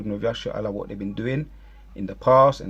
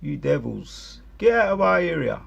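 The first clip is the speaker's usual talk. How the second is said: higher, shouted bear telling them off; voicing the angry bear; 9 semitones higher, 4 dB louder than usual. Voicing the bear yelling at the tourists. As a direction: play the vexed animal chasing them off. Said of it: "He's saying".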